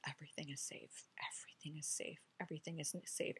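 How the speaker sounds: hushed mantra, fading in; whispered mantra. Shown as a demonstration. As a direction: whisper it like a prayer to yourself. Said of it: "just use".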